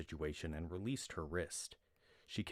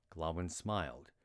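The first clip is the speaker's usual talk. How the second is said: usual speaking voice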